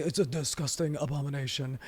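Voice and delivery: low, soft, quick impression; badly faked billionaire, quietly muttered; Elon Musk. Host retrying his billionaire impression, low and subdued. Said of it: "My Elon is not very good".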